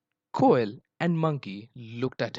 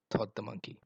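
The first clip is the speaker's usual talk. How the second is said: usual speaking voice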